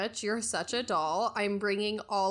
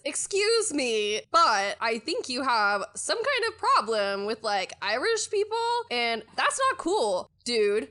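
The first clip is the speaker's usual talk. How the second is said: indignant demand; 3 semitones higher, 5 dB louder than usual. Acted. Shar slams the desk and confronts the man, loud indignant. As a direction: confront him hands on the desk. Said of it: "slams her hands down and says".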